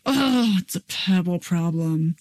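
exasperated "oh" easing into a subdued concession; grudging kid admission. Acted. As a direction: play a kid reluctantly calming down. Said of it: "catch 'em before they go down the slide".

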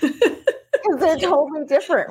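amused, giggling disbelief; laughing. Real, not acted. Laughing about a child's behaviour.

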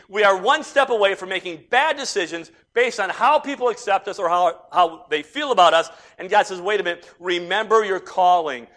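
loud, urgent pulpit warning; forceful preaching. Real, not acted. Preacher warning the church about chasing acceptance, forceful preaching. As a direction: warn them at full voice.

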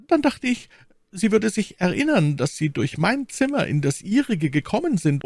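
very high, urgent maid's voice; aufgelöste Ketty. Acted. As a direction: plead in a high voice, reproaching him. (aufgelöste, sehr hohe Mädchenstimme)

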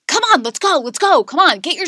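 high, loud, urgent hurrying; retold yelling. Mother hurrying her small kids to school, retold yelling. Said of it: "starting to yell at them".

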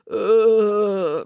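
long dazed groan; moan. A narrator's reading. Coach Voss flattened and concussed in the basket, moaning. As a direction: moan as if knocked silly. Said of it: "moaned Coach Voss".

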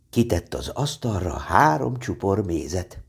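usual speaking voice

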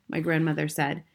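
plain reading voice

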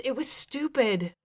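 subdued, regretful confession; remorseful. A narrator's reading. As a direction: admit it with regret. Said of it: "he said with remorse".

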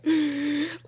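a quiet, sharp gasp of awe; soft awed gasp. Real, not acted. Telling listeners about her worship moment, a soft awed gasp. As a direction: catch your breath in wonder.

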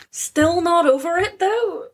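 can't believe she's not over it; incredulous. Real, not acted. Incredulous reaction.

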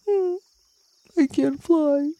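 sad butterfly. Acted.

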